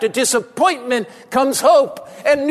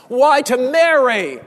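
normal talking voice

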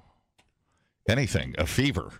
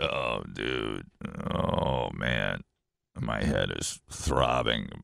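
low, slow, groaning sick voice; fake sick voice; 4 dB quieter, much slower than usual. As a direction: play up being ill to the boss. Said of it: "do the fake sick voice".

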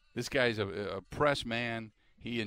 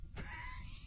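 high squealing laugh; 13 dB quieter than usual. Real, not acted. Laughing at GM's dodge.

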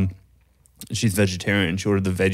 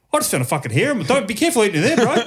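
high, fast, worked-up stranger's voice; agitated bald stranger; 10 semitones higher, much faster than usual. Retold or imitated. Bald man warning passers-by, high fast outraged voice. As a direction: squeak it out like an outraged bloke. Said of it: "bald fellow who just walked out and goes".

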